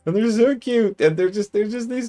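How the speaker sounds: very high-pitched squealing delight; gushing adoration. Real, not acted. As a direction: gush in a tiny, high voice over something adorable. Gushing over cute owl people.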